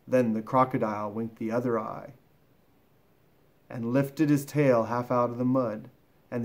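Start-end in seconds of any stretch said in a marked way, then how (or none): none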